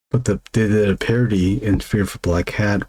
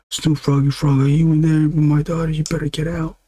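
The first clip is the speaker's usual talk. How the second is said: mock yelling dad; 8 semitones higher than usual. Father at the bedroom door, mock yelling at the rapper. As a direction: bark at the boy through the door, half joking. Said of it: "the dad ... yelling".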